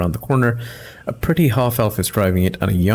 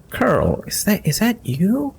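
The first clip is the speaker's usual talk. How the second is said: higher, unsure recognition; a lady knight spotting an old friend; lightly voiced Sela, puzzled; surprised paladin voice; 11 semitones higher, slower than usual.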